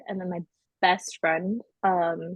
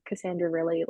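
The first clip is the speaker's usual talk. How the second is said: normal talking voice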